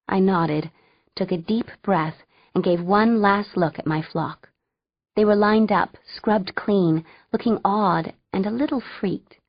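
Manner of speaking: ordinary delivery, nothing marked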